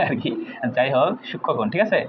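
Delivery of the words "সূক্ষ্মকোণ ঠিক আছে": usual speaking voice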